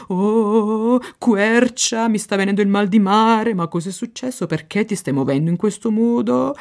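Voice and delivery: high, quick Italian raven voice; a queasy bird fussing; seasick raven. Acted. The raven complains to the oak, quick fussy voice.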